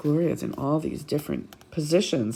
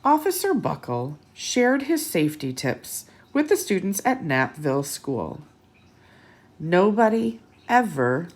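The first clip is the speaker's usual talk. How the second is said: put-on lively read-aloud to children; bright picture-book narration; storybook reading; 2 semitones higher than usual. A narrator's reading.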